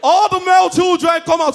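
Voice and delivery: high, loud town-crier call; war summons. Acted. Crier summoning male children to war, loud call. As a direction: call everyone out for battle.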